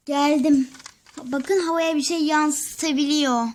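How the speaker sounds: normal talking voice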